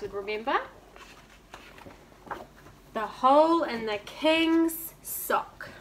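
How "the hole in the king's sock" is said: slow storybook title, each word laid out; slow title reading. A narrator's reading. Reading the story title slowly to young listeners. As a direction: announce the title slowly to the children.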